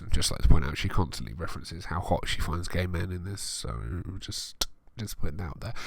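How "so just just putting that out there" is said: ordinary talk, nothing marked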